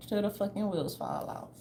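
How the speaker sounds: ordinary talk, nothing marked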